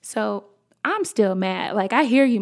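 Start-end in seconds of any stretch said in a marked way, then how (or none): none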